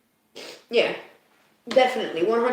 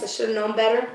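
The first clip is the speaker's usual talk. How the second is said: normal talking voice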